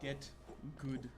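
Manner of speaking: ordinary delivery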